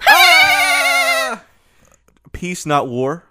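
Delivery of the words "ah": sudden high yelp; startled shriek. Real, not acted. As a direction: let out a shocked shriek. Reaction to pun.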